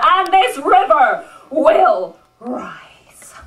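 rousing slam-poetry delivery, swelling and falling; rhythmic live poetry recital; spoken-word poem. Acted. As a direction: perform it as a defiant tribute poem. Performs a spoken-word tribute poem.

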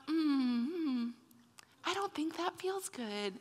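small, soft, hesitant protest; sheepish acted discomfort; reluctant objection. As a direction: hum doubtfully, then object very quietly and slowly. Acted reluctant reply to God's correction.

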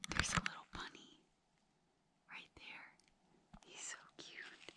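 very soft whisper, slowing at the end; hushed whisper. Real, not acted. Camper points out a little bunny nearby, hushed whisper. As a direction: whisper so the bunny won't bolt.

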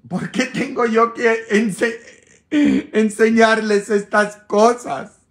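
high and loud, fed up; exasperated outburst. Real, not acted. Priest venting to viewers, exasperated and loud. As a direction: throw up your hands in frustration.

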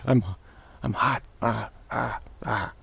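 a hint of a tired crow, croaking; faint, feeble crow caw; weak crow voice. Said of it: "very weak crow voice there".

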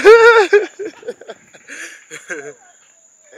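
loud, high, bursting laugh. Real, not acted. Laughs after greeting a friend.

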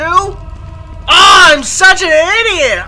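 angry at himself after walking into a trap; self-scolding shout. Real, not acted. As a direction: shout your frustration at yourself.

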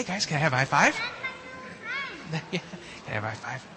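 ordinary delivery

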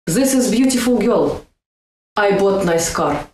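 Russian learner dropping articles; Russian accent demo. Shown as a demonstration. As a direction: leave out "a" like a Russian speaker. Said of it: "we don't have articles".

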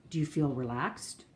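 ordinary talk

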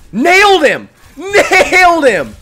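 screamed high and loud, second stretched out; ecstatic shout. Real, not acted. Streamer hyped after landing the kill, screaming. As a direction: yell the kill in triumph.

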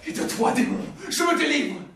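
defiant outburst. Acted. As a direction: throw off the demon with defiance. Defiant refusal of demon.

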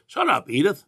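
a quick hammed-up grumpy husband line; Archie Bunker. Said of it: "Archie was telling Edith".

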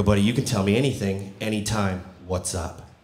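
low, soft, retold reassurance; reassuring. Uncle telling nephew he can share anything, softly reassuring. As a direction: gently invite a child to confide.

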